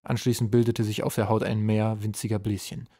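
ordinary delivery

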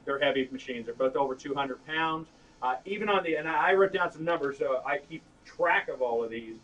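ordinary delivery

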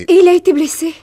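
rising fearful alarm, voice jumping up; alarmed question. Acted. Daughter fearing her father is hurt, alarmed. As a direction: gasp it out, dreading the answer.

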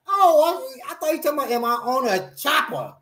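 high, realizing, dawning; mock surprised realization; high surprised self-quote. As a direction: squeak out the realization. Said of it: "I said".

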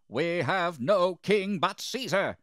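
high, loud, slow answer; defiant declaration. A narrator's reading. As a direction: shout it back firmly at the governor. Chief priests shout back defiantly.

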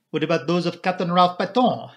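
usual speaking voice

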